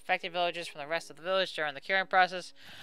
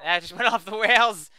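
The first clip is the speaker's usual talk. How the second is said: louder, laughing through the words; 7 dB louder than usual. Real, not acted. Player laughing at own song going off the rails, amused. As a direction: crack up while talking.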